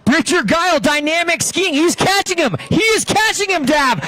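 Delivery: rising into a frantic finish call; excited. Real, not acted. Race finish, commentator climaxes.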